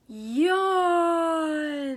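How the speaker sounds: loud drawn-out yawn; big yawn. A narrator's reading. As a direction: stretch into a yawn. Sleepy bear waking.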